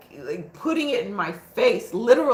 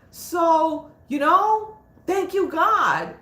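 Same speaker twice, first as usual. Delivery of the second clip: raised, partly slowed thankful prayer; retold prayer to God; 6 semitones higher, slower than usual. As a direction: pray it back as if talking to God again. Praying aloud to God, retold.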